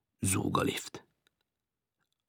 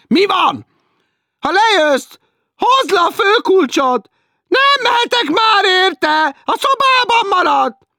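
much higher, loud and slow nagging; shrill teasing woman; 13 dB louder, slower than usual. Acted. Woman below teasing Martin, shrill and slow. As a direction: drag it out like a shrill landlady.

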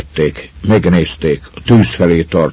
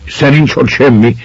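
mild grumbling doubt, a bit louder; half-hearted grumble at a joke; 5 semitones higher, 3 dB louder than usual. A narrator's reading. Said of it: "morogta az egyik".

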